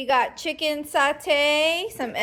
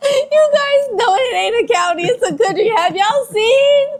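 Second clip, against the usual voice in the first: loud, high, laughing through every word; teasing her husband while cracking up; can barely get the words out for laughing; laughing hard; 10 semitones higher, 8 dB louder than usual. Real, not acted.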